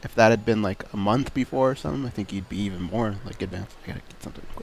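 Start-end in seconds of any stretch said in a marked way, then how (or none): 3.8-4.6 whispering. Real, not acted. Aside off mic.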